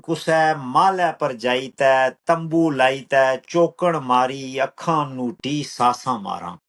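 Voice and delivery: slow, measured verse with drawn-out words; poetry recital. Acted. Poet reciting own lines to an appreciative listener, measured recital.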